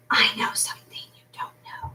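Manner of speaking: hushed, knowing whisper; secretive whisper. A narrator's reading. Shepherdess look, read as whisper.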